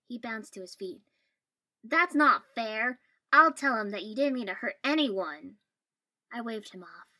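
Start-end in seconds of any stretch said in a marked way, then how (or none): 1.8-5.6 loud, indignant objection; outraged protest. A narrator's reading. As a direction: protest loudly on a friend's behalf.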